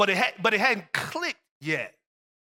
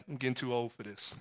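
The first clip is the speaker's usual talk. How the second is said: low, soft, weary mutter, quick; tired old dad; 10 semitones lower, 12 dB quieter, faster than usual. Retold or imitated. Shaken father telling his son after the ride, low and weary. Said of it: "It's like".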